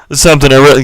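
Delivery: blasting into the microphone; right up on the mic. Real, not acted. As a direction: lean in close to the mic, booming. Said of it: "get away from the microphone".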